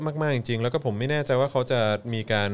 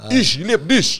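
loud, high mock-German outburst; hammed-up harsh German accent; forceful German; 10 semitones higher, 8 dB louder than usual. Shown as a demonstration. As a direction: belt it out like exaggerated, forceful German. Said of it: "มันเป็นภาษาที่แข็งแรง".

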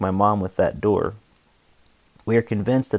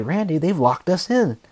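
higher, imitating a panicked mother; mock frantic; 8 semitones higher than usual. Mother hurrying down to wake the father, mock frantic. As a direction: retell her panic, voice pitched up. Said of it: "saying frantically".

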